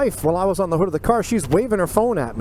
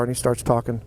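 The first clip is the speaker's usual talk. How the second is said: ordinary delivery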